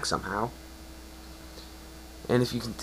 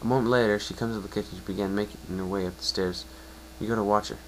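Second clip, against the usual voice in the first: usual speaking voice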